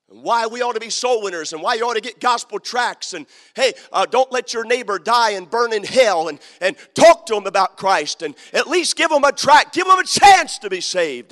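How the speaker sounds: loud, rising pulpit exhortation; fiery revival preacher urging soul winning; preaching at full force. Acted. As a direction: drive it home to the pews.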